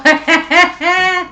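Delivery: high, loud burst of laughter. Real, not acted. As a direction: burst out laughing at your own mistake. Realises own word mix-up.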